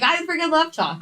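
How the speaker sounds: high, fast, gleeful gush; excited fangirl reaction. Retold or imitated. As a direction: squeal it like a tacos fan. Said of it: "And I'm like".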